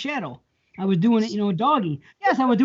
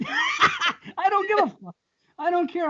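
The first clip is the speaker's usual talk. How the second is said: defiant shout; 8 semitones higher, slower than usual. Real, not acted. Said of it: "defiant about saying it".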